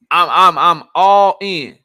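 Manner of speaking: I'm all in, slow and loud; emphatic slow declaration. Real, not acted. Vlogger to viewers, slow emphatic all in. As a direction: lean hard into all in.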